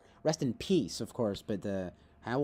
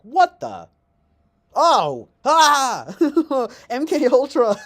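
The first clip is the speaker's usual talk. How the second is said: sudden shrill mock scream, much higher and louder; 11 semitones higher, 12 dB louder, much slower than usual. Startling a dozing viewer, mock screaming. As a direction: scream in fake shock. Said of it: "I just screamed in their poor ear".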